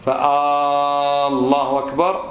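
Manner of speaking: فالله أكبر with a long chanted "aaa" before the l; slow, sung-out first vowel in فالله; interrogative long alif. Shown as a demonstration. Teacher repeating the faulty takbir, stretched opening. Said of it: "معنى استفهام".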